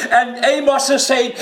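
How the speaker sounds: ordinary talk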